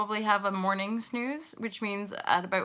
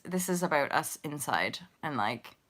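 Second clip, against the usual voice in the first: usual speaking voice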